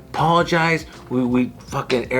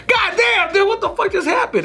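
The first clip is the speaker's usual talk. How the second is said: shocked. Retold or imitated. Retold shock at the call.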